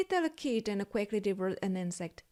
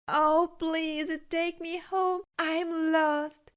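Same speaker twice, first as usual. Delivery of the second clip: begging; 7 semitones higher, slower than usual. A narrator's reading. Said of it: "begged the little kitten".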